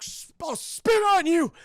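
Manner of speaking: slow, high, loud sputtered threat; defiant mock shout. Beaten man insults his torturer, slow mock-defiant shout. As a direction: drag out the insult like a beaten hero.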